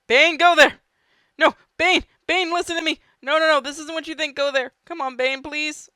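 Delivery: high, loud frantic pleading; desperate yelling. Real, not acted. Gamer yelling at Bane as the plan goes wrong, frantic. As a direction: beg the character to listen, yelling.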